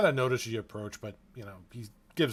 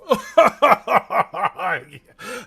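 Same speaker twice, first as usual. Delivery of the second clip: loud booming belly laugh; hearty dwarven laugh; 11 semitones higher, 17 dB louder than usual. Acted. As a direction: roar with laughter. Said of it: "the dwarven laugh".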